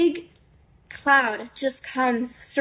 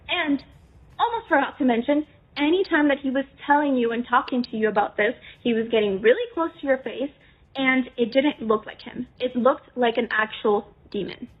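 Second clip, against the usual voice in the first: usual speaking voice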